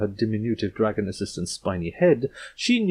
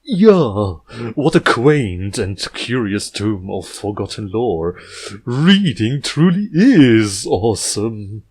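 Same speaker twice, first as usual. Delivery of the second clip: louder, slow, burbling eldritch delight; burbling creepy voice; 2 semitones higher, 9 dB louder, much slower than usual. Acted. Horror author praising the display, slow loud burble. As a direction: savour each word as it carries. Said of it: "Glubcraft's burbling voice".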